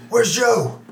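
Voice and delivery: high-pitched desperate yell, held back in volume; mock screaming. Game master voices a man dragged by goblins, mock screaming. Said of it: "he's just screaming".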